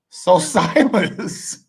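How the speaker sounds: high, drawn-out words through laughter; laughing. Real, not acted. Host turning to Silas after his fable, laughing. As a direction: laugh your way slowly into the name.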